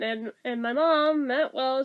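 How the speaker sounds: usual speaking voice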